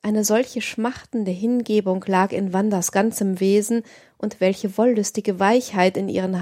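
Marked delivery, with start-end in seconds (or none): none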